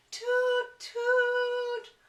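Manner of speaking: high loud sung toot like a train whistle. A narrator's reading. Storyteller imitating the four o'clock train whistle, high sung toot.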